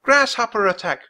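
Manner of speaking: high, loud shout of warning; alarmed cry. A narrator's reading. Boy echoes his sister's grasshopper warning, alarmed cry. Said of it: "cried Will".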